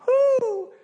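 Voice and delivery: loud high whoop breaking into a laugh; high woo with a laugh. Retold or imitated. Fans celebrating ordinary cake, high laughing whoop. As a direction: cheer like a hyped fan. Mock trend cheer.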